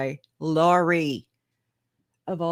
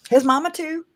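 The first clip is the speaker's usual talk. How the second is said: squeaky high jab at his mama; high mock emphasis; 11 semitones higher than usual. Streamer teasing about Chad's mama, squeaky high. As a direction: pitch it way up for the joke.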